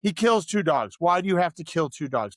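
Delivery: ordinary delivery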